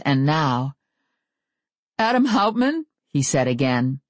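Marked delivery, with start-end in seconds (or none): 2.0-2.9 nervous squeak on the name; squeaky voice. A narrator's reading. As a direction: voice cracking up high. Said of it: "His voice squeaked".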